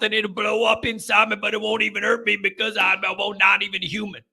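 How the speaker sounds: put-on garbled, slurred Cajun coach accent. Coach rants about bottle rockets and LSU, thick Cajun accent. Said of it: "Doing accents is fun".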